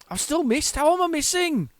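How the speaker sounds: very high incredulous squawk; frustrated gamer's high-pitched protest; exasperated disbelief. Real, not acted. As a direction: complain in a high, baffled voice. Missed again in the game.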